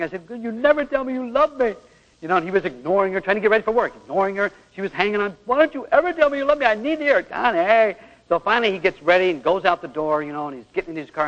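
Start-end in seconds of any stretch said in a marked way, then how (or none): 0.3-1.7 higher voice of a hurt wife; pleading wife. Retold or imitated. Wife begging husband to say I love you, pitched up. Wife's complaint voiced in story. 5.4-8.0 high pleading voice; pleading wife. Retold or imitated. Wife begging husband as he readies for work, high and pleading.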